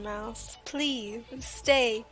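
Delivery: normal talking voice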